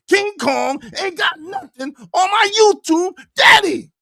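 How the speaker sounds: high, loud hyped-up yelling; yelling hype at the chat; hype shouting. Pretend. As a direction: hype the crowd up at full volume. Hyped threats about YouTube daddy.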